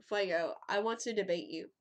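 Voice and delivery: ordinary talk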